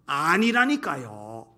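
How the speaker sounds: low, slow, stretched-out denial; emphatic denial. Real, not acted. Preacher denying that Moses' faith had fallen, slow insistent denial. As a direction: draw it out with weighty insistence.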